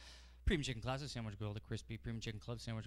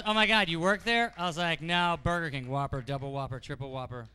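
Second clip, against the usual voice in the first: big loud punch; 5 semitones higher, 15 dB louder than usual. Acted. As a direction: shout the punchline, much louder and higher. Comic shouting the punchline.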